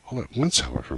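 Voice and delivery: usual speaking voice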